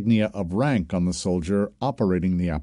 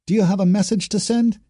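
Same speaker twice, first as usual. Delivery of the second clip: high, louder military query; trooper reporting in; 11 semitones higher, 5 dB louder than usual. A narrator's reading. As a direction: ask a superior in a high trooper's voice. Trooper addressing a superior officer.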